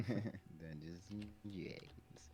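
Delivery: slow, soft whisper; hushed echo. Pretend. Player echoing the call back to the game, in a hushed whisper. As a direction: whisper the game's name slowly.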